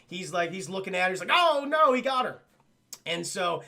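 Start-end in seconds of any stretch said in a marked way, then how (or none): 1.3-2.4 high, loud cry of dismay; mock alarm. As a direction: cry out a hammed-up "oh no", high-pitched. Voicing a bystander's alarm at abduction.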